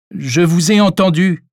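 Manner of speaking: loud, high-pitched call; calling out loudly. A narrator's reading. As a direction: shout it so a hidden person hears. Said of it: "cria le docteur".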